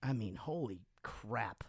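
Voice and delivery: whispered, slow holy crap. Real, not acted. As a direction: breathe it out in awe. Awed at band's twenty-five years.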